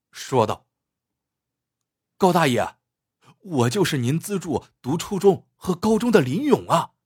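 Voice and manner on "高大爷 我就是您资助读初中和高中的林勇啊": raised, eager, delighted voice; overjoyed. Acted. Grown man revealing himself to his former benefactor, overjoyed. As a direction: burst out with happy recognition. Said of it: "林勇大喜，说道".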